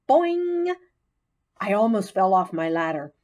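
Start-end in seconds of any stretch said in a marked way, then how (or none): 0.1-0.8 high, loud springy boing; ping it out like a spring; frog's bright twanging boing. Acted.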